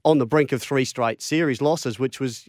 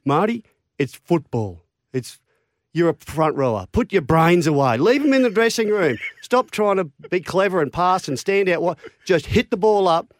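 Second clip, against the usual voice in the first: Wayne Bennett dressing-down; 4 semitones higher than usual. Retold or imitated. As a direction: lay into him like a harsh coach. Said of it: "But he said".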